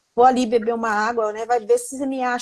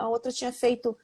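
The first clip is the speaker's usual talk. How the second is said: ordinary talk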